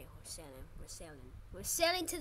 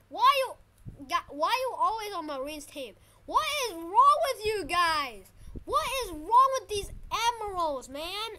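loud, indignant complaint; exasperated yelling; 3 semitones higher, 8 dB louder than usual. Real, not acted. Gamer asking what is wrong with you guys, exasperated yelling. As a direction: yell in frustration at the other players. Railing at the other players.

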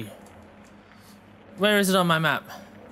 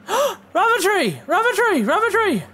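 much higher, loud joyful shouts; thrilled cry; 12 semitones higher, 4 dB louder than usual. Real, not acted. Gamer spotting the long-sought tree, excited shouting. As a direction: yell it like finding treasure. Finally finds the rubber tree.

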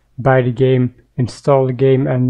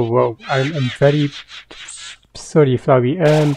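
normal talking voice

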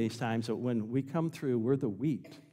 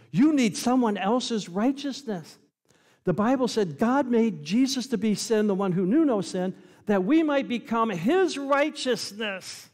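high, loud pulpit proclamation, stretched at the ends; full-force preaching; 9 semitones higher, 6 dB louder than usual. Real, not acted. Preacher tells congregation they need another's righteousness, full-force preaching.

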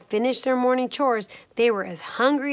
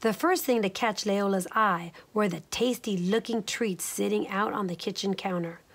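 plain reading voice

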